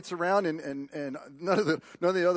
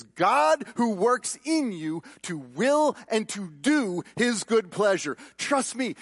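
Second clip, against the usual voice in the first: slow, raised preacher voice; emphatic preaching; 5 semitones higher, 4 dB louder, slower than usual. Acted. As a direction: preach it high, stretching the key words.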